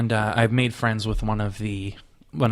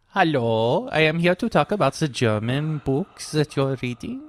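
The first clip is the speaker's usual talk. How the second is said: guest with a put-on German accent; Klaus; 3 semitones higher than usual. Host playing the guest, in a German accent. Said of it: "doing a German accent".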